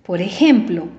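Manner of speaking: normal talking voice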